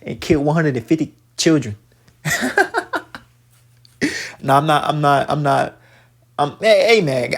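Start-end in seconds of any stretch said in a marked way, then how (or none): 2.2-3.2 high-pitched derisive laugh; real laugh at the heathens; mocking laugh. Real, not acted. Commentator laughing at the heathens he denounces, mocking laugh. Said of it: "yeah, I am laughing".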